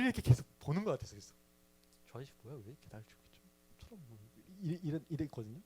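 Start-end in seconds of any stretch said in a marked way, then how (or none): 2.1-4.2 quick, hushed muttering to himself; much softer, a puzzled aside under the breath; hushed inner thought. Retold or imitated. Boy wondering about the staring man, hushed and quick.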